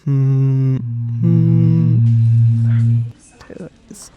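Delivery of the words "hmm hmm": loud, sustained steady hum; imitated hum. Shown as a demonstration. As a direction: mimic a droning engine-like hum. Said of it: "maybe hum is a better description".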